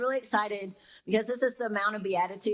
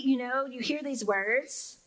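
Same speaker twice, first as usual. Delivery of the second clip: ordinary delivery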